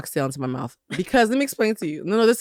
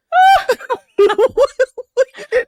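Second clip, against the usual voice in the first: shrieking laugh; 9 dB louder than usual. Real, not acted. Reacting to yoni meaning.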